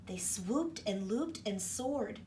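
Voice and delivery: normal talking voice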